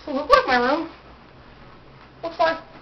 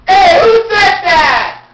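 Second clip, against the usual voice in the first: loud, slow, indignant yell; shout; 8 semitones higher, 13 dB louder, slower than usual. Real, not acted. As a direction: bellow at the heckler. Offscreen shout at heckler.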